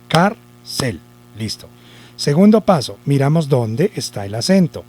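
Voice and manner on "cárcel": CÁR-CEL in two separate beats; "Cárcel" broken slowly into syllables; split into syllables. Shown as a demonstration. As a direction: say "Cárcel" syllable by syllable, with a clear gap. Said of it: "Separamos por sílabas".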